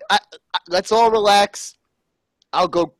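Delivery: ordinary talk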